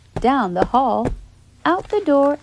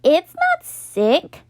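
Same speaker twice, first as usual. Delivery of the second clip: higher, louder and slow, leaning on NOT; Carla protesting; 10 semitones higher, 5 dB louder, slower than usual. A narrator's reading. Carla answering Nate, slow insistent denial. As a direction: dig in and deny it. Carla defending her sandwich.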